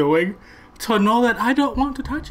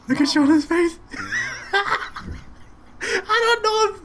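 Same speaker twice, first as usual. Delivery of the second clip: laughing; 11 semitones higher than usual. Real, not acted. Laughing at friend's reaction on video.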